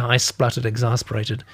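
ordinary delivery

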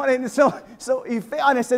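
usual speaking voice

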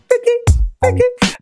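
high, sharp vocal scratch noise; high-pitched "vicky" scratch effect; mimicked DJ record scratch. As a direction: squeak out a quick turntable scratch. Mimics record scratches in mock rap.

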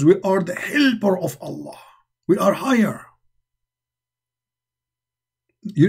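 mock proud boast, lightly voiced. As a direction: sneer it like a smug claim. Mocking the Muslims' claim.

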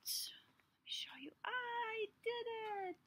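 whispered wonder; awed whisper. Acted. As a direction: breathe it out amazed. Seed whispers in awe.

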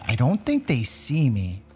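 usual speaking voice

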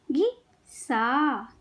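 घिसा very slowly, pause after घि; syllable by syllable. Shown as a demonstration. Teacher teaching kids to read, syllables apart. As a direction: break घिसा into घि - सा. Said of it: "शब्द पढ़ना सिखाऊंगी".

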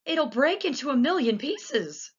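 high, anxious warning; fearing disaster; worried outcry; frightened cry. A narrator's reading. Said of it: "shouted Grandpa Joe".

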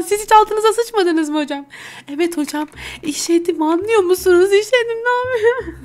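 put-on high, pleading kid who wet himself; high-pitched embarrassed pupil; whiny schoolkid. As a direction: wail it at the teacher, high and helpless.

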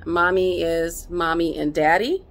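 ordinary talk

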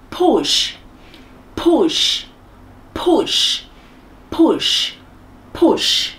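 "push" with short, lax u and long sh; short vowel in "push", then let the sh run. Shown as a demonstration. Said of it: "Letter U được đọc là O".

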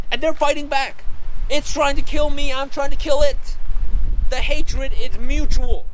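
loud, high-pitched comic outrage, nearly yelling; mock-outraged rant. Camper ranting about nature as an enemy, mock outrage. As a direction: rant at full pitch as if at war.

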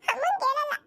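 high, whimpering plea; pleading child. Acted. Grandson begging granny not to hit him, high crying plea.

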